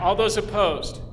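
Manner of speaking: slow, drawn-out formal call to the room. Shown as a demonstration. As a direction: put it to the hall with mock ceremony. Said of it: "I'm, I'm just being formal".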